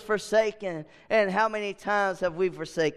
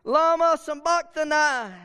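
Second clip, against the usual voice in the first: higher, louder cry quoting Jesus, a touch held back; 8 semitones higher, 4 dB louder than usual. Retold or imitated. Preacher voicing Jesus crying to God, a mild loud cry. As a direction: cry out as from the cross. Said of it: "Jesus cried with a loud voice".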